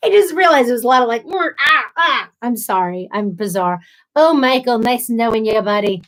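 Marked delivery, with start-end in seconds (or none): 1.2-2.3 high, squawked grunts and cries; imitated fit noises. Recapping her bodily fit, mock squeals of revulsion. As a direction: mimic your own revolted outbursts. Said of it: "some sort of bodily fit".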